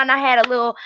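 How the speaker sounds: normal talking voice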